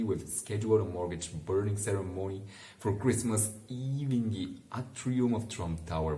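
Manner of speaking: ordinary delivery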